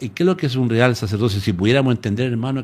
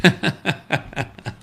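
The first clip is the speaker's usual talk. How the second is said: laugh; 6 semitones higher than usual. Real, not acted. Laughs at own slip.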